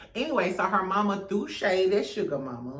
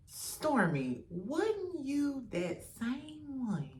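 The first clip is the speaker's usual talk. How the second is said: soft, slow repeat; 5 semitones higher, 9 dB quieter, much slower than usual. Real, not acted. Repeating the call-out softly.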